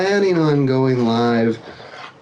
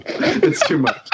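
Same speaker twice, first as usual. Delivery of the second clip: laughing; 5 semitones higher, much slower than usual. Real, not acted. Laughing fit, can't stay serious.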